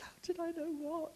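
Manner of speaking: gentle puzzled whisper; whispered reply. Retold or imitated. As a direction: answer her hush in kind. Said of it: "I said".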